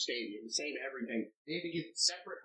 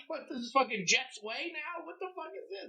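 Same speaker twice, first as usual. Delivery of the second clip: incredulous; 13 semitones higher than usual. Retold or imitated. Reacting to Jets street signs.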